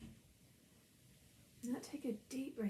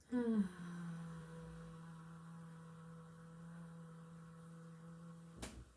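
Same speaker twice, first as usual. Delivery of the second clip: long, softer sustained "ahh" on the out-breath; sighed "ahh"; 3 semitones lower, 5 dB quieter than usual. Shown as a demonstration. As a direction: exhale on a held, gentle "ahh". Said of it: "make the sound".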